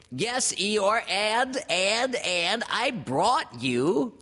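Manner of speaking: high, loud, stammering little-creature voice; piercing pipe that hesitates and drags; Piglet. A narrator's reading. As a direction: squeak it out, stretching as you stall. Piglet about to confess.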